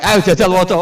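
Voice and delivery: high, loud order; commanding shout. Acted. Munkar Nakir ordering Umar to read, high loud command.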